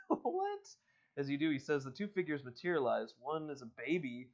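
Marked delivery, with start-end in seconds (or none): 0.1-0.6 high incredulous question; startled, high, louder what; surprised what. Real, not acted. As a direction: ask it in high disbelief.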